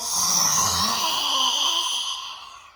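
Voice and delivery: big loud laugh rising. Real, not acted. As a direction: burst out laughing loudly. Laughs after growl exhale.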